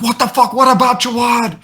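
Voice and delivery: high-pitched mock panic; imitated panicked outburst. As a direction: cry out for a missing friend. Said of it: "he can't take it anymore".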